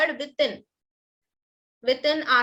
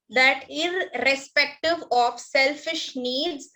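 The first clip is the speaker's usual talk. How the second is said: slow dictation; slower than usual. Shown as a demonstration. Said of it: "teacher dictating to class".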